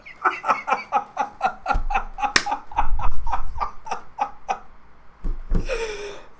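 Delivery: long high breathless laugh; real high cackling laughter; shrieking laughter. Real, not acted. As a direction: crack up helplessly at the twist. Reader cracks up at ending.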